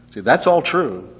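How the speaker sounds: "all" punched loud; emphatic teaching; stress on all. Shown as a demonstration. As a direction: hit "all" hard.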